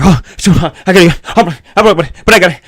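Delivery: mock frantic packing, tripping over the words; frantic panic. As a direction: play someone rushing around in a panic. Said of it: "than you can frantically".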